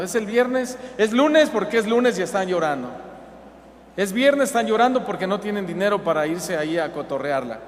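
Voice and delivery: a hint of an exasperated parent; exasperated mockery. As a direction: scold them in mock disbelief. Mocking complainers' constant crying.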